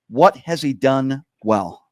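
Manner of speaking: slow, deliberate question at half speed. Real, not acted. Host slowing down a rambling caller with a question, slowed down. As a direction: ask it slowly, word by word. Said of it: "let's try to slow down a little bit".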